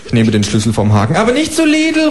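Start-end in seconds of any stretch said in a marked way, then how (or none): 1.1-2.1 very high, called-out kangaroo; kangaroo calling after. Acted. Kangaroo calling after the narrator leaving, very high. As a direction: yell it after him, squeaky. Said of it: "ruft mir das Känguru hinterher".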